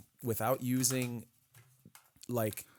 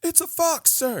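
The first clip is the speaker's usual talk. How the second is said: high, loud shouted answer; recruit shout; 8 dB louder than usual. Retold or imitated. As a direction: yell it like a scared recruit. Recruit answering drill instructor loudly.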